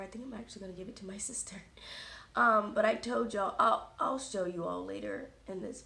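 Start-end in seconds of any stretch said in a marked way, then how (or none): 0.0-1.6 quick whispered aside. Real, not acted. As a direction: whisper it fast under your breath. Whispered aside about sister.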